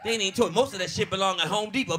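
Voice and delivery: usual speaking voice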